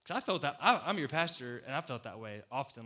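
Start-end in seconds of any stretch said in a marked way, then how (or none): none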